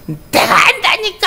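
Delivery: put-on high, slow childish yell; a child refusing help, shouting high; stubborn child shout. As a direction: play a defiant little kid insisting. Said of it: "막 큰 소리 치고".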